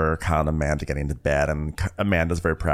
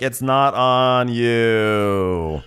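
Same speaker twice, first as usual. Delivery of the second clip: high, very slow, cooing; mock soothing girls; 6 semitones higher, 4 dB louder, much slower than usual. Co-host mocks the girls consoling Lindsay, slow high coo. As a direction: coo it like fake comfort.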